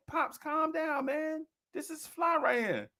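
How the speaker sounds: put-on high, soft, slow little-boy voice; placating kid. Boy tells angry father to calm down, high soft cockiness. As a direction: soothe him as your child self, high and soft. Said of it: "I'm like".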